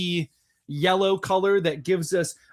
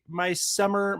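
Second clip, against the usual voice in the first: usual speaking voice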